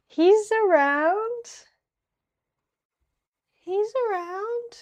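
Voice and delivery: high, drawn-out, swooping reassurance; unconvincing high-pitched cover-up; evasive singsong. Pretend. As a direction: dodge the question in a stretched high voice. Evasive about missing alien scout.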